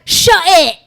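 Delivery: high, loud mock Australian snarl; Australian accent. Mocking the director in an Australian accent. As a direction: bark it in a put-on Aussie accent. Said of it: "Whenever I do an accent".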